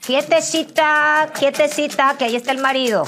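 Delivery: high, loud, stretched-out warning; hammed-up high-pitched reprimand; mock self-scolding. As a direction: order yourself to behave, comically loud. Scolds herself at handsome man.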